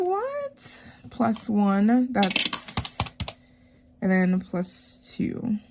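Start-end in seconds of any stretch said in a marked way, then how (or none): 0.0-0.8 very high, softened squeak of "what?"; baffled disbelief. Retold or imitated. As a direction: squeal it softly in mock disbelief. Acting out her baffled reaction.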